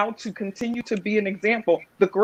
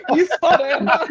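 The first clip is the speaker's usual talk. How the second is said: higher, louder laughing tease; 9 semitones higher, 7 dB louder than usual. Real, not acted. Wife teasing the host about his age, laughing. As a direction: catch him out and crack up.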